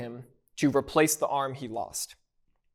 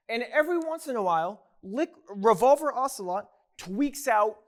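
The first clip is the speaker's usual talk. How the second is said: ordinary talk, nothing marked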